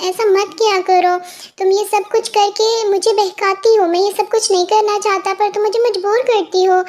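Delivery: normal talking voice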